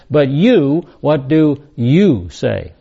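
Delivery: slow, emphatic challenge landing hard on "you"; stress on YOU. Acted. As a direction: punch both instances of "you", slowing right down. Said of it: "the Greek is very, very emphatic".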